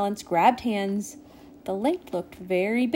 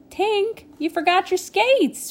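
high, raised calling voice; 11 semitones higher, 4 dB louder than usual. A narrator's reading. Prilla to Tink on the lake, calling out. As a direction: call it across the ice. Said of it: "Prilla called".

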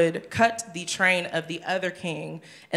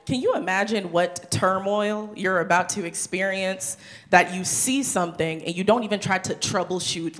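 ordinary talk

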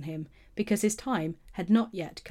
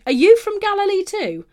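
higher, louder scoffing question; incredulous retort; 12 semitones higher, 10 dB louder than usual. A narrator's reading. Leaders scoff at Nicodemus for defending Jesus, incredulous and loud. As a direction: fling the question back in disbelief.

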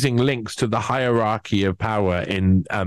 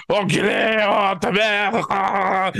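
high, mocking French outburst; mock French cursing; 9 semitones higher, slower than usual. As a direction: swear like an angry Frenchman, pitched up. French people raging at clamps.

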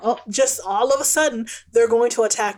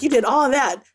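usual speaking voice

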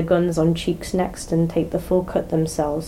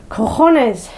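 sharp, loud shout of pain and anger; loud angry swear; loud curse; 6 semitones higher, 5 dB louder than usual. Acted. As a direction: blurt it out, furious. David swears after crash.

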